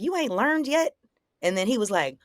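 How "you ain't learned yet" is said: high-pitched incredulous taunting question; retold taunt. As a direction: goad him with a pitched-up question.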